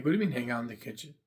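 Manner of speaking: ordinary talk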